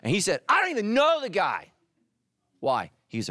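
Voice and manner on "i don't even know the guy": high, loud protest of innocence; vehement denial. Acted. Peter denies Jesus to the woman, high vehement denial.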